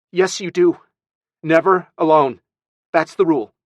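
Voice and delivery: loud, high, emphatic rule-giving; stern scolding. Acted. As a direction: lay down the law to a child. Aunt lays down the rule.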